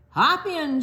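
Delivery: high, loud bright call of Hop in; cheery driver call. Acted. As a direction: call out like a friendly race car. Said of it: "she said".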